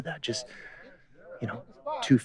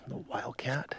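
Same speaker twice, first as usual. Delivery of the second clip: golf commentator; 3 semitones higher, 4 dB quieter, slower than usual. Acted.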